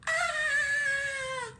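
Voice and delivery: long, high storybook scream; frightened scream. Acted. Three bears scared by Goldilocks, high scream. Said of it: "they screamed too".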